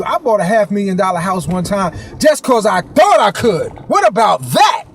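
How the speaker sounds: ordinary talk, nothing marked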